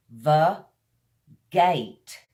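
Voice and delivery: Va, gate said apart, firm on each part; syllable drill. Shown as a demonstration. Teacher breaking a word apart for learners, deliberate. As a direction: split Va and gate cleanly. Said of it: "kind of put your teeth on the front lip".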